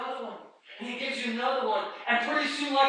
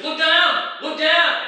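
high, loud taunting shouts; Satan's taunting; 7 semitones higher, 11 dB louder, much slower than usual. Acted. Satan distracting the walker, loud taunting. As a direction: yell it to break the walker's focus. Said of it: "And Satan says".

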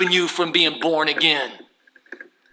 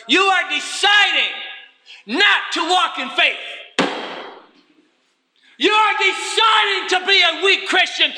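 fiery preaching; 8 semitones higher than usual. Acted. Preacher shouting at his weak congregation. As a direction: drive it home to the congregation.

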